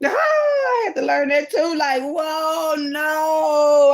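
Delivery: high shouting laugh-cry; loud laughing outburst. Real, not acted. Telling viewers she learned it too, shouting and laughing. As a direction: burst out laughing loudly.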